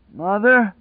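high, uncertain call; doubtful high-pitched cry; weak doubt. A narrator's reading. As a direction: call out, doubting anyone will answer. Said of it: "now full of weak doubt".

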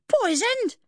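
very high, loud indignant shriek; outraged woman's screech. A narrator's reading. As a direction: shriek it back in disbelief. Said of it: "the woman shrieked".

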